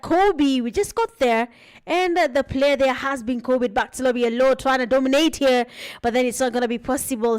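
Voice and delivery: energetic live esports caster; play-by-play commentary. Acted. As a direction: call the match as it happens.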